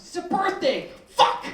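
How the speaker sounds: put-on kid's outburst. Child explains, comic yell.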